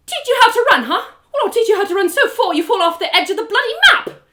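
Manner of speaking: high, loud snarling threat; furious threat. Acted. Trinia snapping back at her taunting sparring partner, furious. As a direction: spit it back at him in rage.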